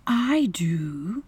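tender mother. A narrator's reading. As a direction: comfort a hurt child in a whisper. Mother comforting her hurt child.

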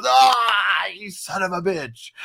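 high, loud wail then curse; hammed-up pained cursing; mock outraged yell. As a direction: imitate a helpless victim's outcry. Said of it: "with his hands full".

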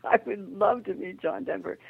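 high, delighted gush with a laugh; thrilled gasp. Retold or imitated. To boyfriend [name] about meeting [name], thrilled and high. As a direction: gush like a starstruck fan. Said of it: "And I went".

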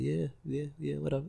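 very soft, brushing-off yeahs; dismissive murmur. Retold or imitated. Speaker brushing off the stressed guy, soft dismissive yeahs. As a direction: wave him off under your breath.

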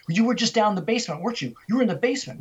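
high, hurried accusation; snapped, accusing. Retold or imitated. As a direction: fire it at her, rapid and insistent. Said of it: "he just snapped".

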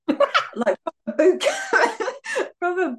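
words breaking up into laughter; laughing. Real, not acted. Friend describing a shouting satnav sergeant, laughing. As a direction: giggle through the description.

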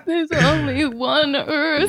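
hammed-up crying, drawn out; mock bawling. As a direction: wail it like a distraught child. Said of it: "this kid was just losing his mind".

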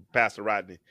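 normal talking voice

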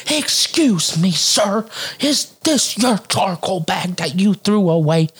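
high, slow, pointed mimicry; irate neighbor lady. Lady confronting the man in his garage, mock indignant. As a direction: stretch it out with mock-polite indignation. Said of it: "she goes straight in his garage".

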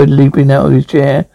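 ordinary delivery

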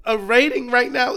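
exasperated disbelief. Retold or imitated. Fan ranting at morning sports show in disbelief. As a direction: throw your hands up at the TV. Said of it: "I was like".

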